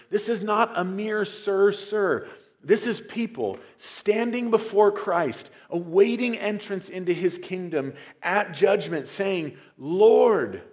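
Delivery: loud, high pleading preaching; preaching at full force. Acted. Preacher voicing the pleading crowd before Christ, loud and high. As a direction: let the judgment day plea ring out.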